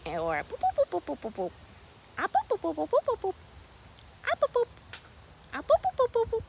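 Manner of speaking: high, loud mock duck boops. Mimicking her ducks for the camera with high boops. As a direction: boop it out like a little muscovy duck. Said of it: "some ducks go".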